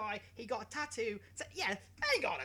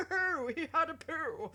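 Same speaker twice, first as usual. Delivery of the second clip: lightly put-on posh accent, higher and louder, through a laugh; giggling while putting on a mild posh voice; 8 semitones higher, 6 dB louder than usual. Imitating a posh dog-show commentator, laughing. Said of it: "Like, really posh".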